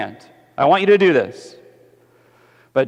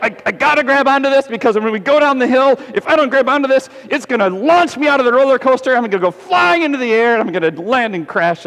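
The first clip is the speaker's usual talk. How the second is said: high, fast, loud panic about flying out; panicky rider; 10 semitones higher, 5 dB louder, faster than usual. Retold or imitated. Scared kid on the coaster, high fast panic. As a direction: gasp it out like a scared first-timer on the drop. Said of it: "You're like".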